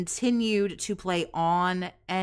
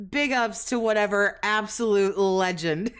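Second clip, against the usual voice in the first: ordinary delivery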